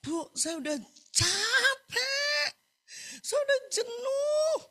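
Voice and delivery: very high, drawn-out mock crying; slow, high-pitched acted sob; imitated tearful wail. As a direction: imitate a weeping woman pouring her heart out. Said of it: "dia curhat bahwa dia nangis".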